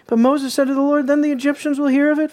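loud, fast declaimed scripture; loud preaching read. Shown as a demonstration. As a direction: reread it with full sermon force. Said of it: "Oh, excuse me, verse twelve".

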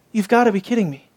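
usual speaking voice

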